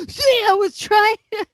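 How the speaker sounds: exaggerated imitation of someone protesting; imitated pleading. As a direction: jump up high as if pleading your case. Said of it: "Then he goes back to".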